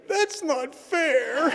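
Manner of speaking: high, slow, whiny little "not fair"; little wimpy voice. Retold or imitated. Sponsee on the phone, in a little wimpy voice. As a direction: imitate a small wimpy man caught out. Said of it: "this little wimpy voice go".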